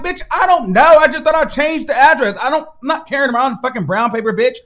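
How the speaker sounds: high, loud exasperated outburst; fed up with the clerk; exasperated rant. Retold or imitated. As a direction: snap back at the clerk. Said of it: "I'm like".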